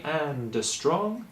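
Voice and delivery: "and a strong" said slowly, D released into a small "a"; slow, tiny vowel after "and". Shown as a demonstration. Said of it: "Just say for me".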